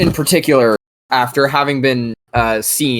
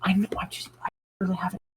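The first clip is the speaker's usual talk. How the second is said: whispering; 6 semitones higher, 12 dB quieter, much faster than usual. Real, not acted. Aside whisper to partner.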